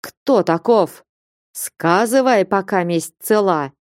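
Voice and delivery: plain reading voice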